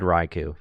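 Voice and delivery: Raikou said as RYE-koo; habitual old pronunciation. Shown as a demonstration. Host admitting the lifelong habit, Raikou as RYE-koo. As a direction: Raikou ending in a long "oo". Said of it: "I have always said Raikou".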